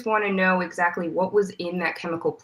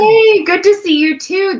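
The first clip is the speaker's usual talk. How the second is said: much higher and louder hello; excited greeting; 12 dB louder than usual. Real, not acted. Old colleagues greet on air.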